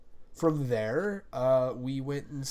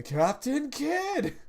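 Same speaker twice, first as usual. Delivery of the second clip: drawn-out, much higher cry; very slow, shout it like a battle summon; mock summon call; 11 semitones higher, 4 dB louder, much slower than usual. Hyping friends by calling out Captain Kidd, a slow mock battle cry.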